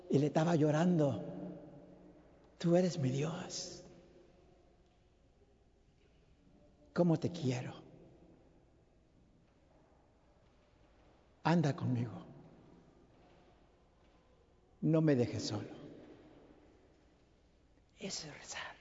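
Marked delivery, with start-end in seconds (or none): 2.6-15.8 slow, soft and low, heart poured out; slow tender prayer. Shown as a demonstration. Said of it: "lo digo despacio". 18.0-18.8 a breathy whispered aside. Real, not acted. Hushed aside after prayer.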